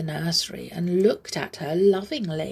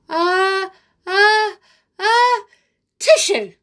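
rising, much higher and louder, exploding into a sneeze; big sneeze; 14 semitones higher, 9 dB louder, slower than usual. Acted. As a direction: build up and burst out the sneeze. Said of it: "all Amelia said was".

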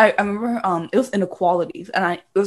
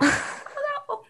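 slow, high, soft mock whimper; tiny tearful squeak; imitating crying sister; 6 dB quieter, much slower than usual. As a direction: drag it out in helpless pretend tears.

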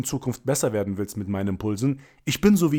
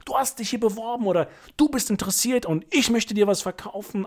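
much higher, breezy pitch voice; casual sales pitch; 10 semitones higher than usual. Retold or imitated. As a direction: toss it off like a relaxed seller. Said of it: "und sagst". (locker gespielter Verkäufer, viel höher)

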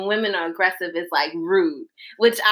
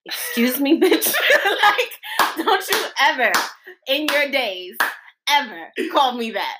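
higher-pitched sassy telling-off, laugh breaking in; mock indignation; 5 semitones higher than usual. Girlfriend warning her boyfriend off "old lady", mock-indignant. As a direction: playfully lay down the law. Acts out scolding boyfriend over nickname.

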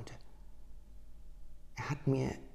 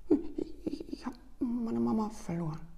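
put-on lost little child; 11 semitones higher than usual. Crying lost child answers.

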